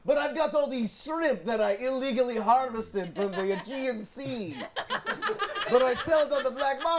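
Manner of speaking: put-on hammy Greek fisherman accent; overdone Greek accent, laughing through it; bad Greek accent. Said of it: "It's the worst Greek accent ever".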